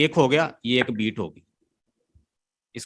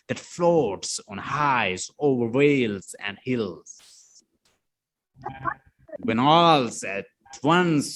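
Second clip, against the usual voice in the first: very slow, each stressed beat marked; slow metrical poem reading; scanned recitation; 3 semitones lower, much slower than usual. Shown as a demonstration. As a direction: read the verse beat by beat, stretching the stresses. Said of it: "stress का मतलब जहां पे आप थोड़ा सा जोर देते हैं".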